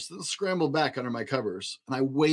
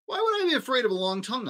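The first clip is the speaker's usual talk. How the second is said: fast, high and doubtful; 10 semitones higher, faster than usual. Acted. Child challenges monster quickly.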